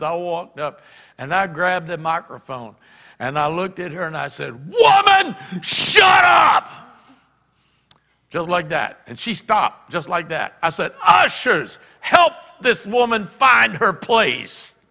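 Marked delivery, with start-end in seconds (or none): 4.5-6.7 high, loud full-voiced yell; commanding shout. Preacher silencing a disruptive woman from the microphone, shouting. 11.1-14.8 raised, higher voice, stretched out; loud command. Retold or imitated. Preacher ordering ushers to remove a woman, loudly.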